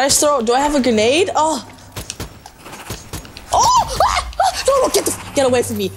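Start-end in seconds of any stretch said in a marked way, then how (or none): 3.5-5.9 high startled yelps; startled panic. Real, not acted. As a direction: yelp and blurt in real panic. Ambushed by enemies in game.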